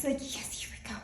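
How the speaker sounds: mock whisper, breathy and hushed; a hushed little yes, here we go; whispered aside. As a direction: whisper it to yourself, bracing for the talk. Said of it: "and I'm like".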